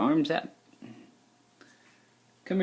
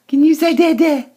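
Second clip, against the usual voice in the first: excited coaxing; 8 dB louder than usual. Shown as a demonstration.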